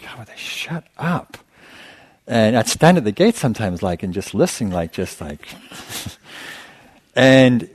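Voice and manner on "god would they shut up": fed-up whisper. Retold or imitated. Irritated meditator on silent retreat, whispered complaint. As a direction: hiss it under your breath in frustration. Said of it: "it's like".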